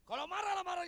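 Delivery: high, loud burst; mock shout. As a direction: shout it to the crowd. Mock shout about angry wife.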